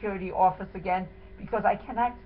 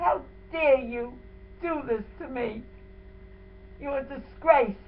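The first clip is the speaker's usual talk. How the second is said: slow, tearful, higher-pitched indignation; reenacted outrage; 4 semitones higher than usual. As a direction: relive the fury, voice close to crying. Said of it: "I have to say them the way I said it".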